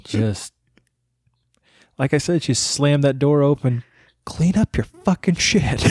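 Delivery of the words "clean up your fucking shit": a hint of a toddler shouting the line; a slower, raised retelling of a swearing kid; imitated kid. Dad retells his toddler's swearing, lightly voiced.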